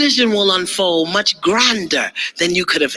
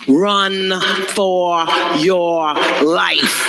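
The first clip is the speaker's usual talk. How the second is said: slow drawn-out; much slower than usual. Shown as a demonstration. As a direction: Run for your life stretched very slow.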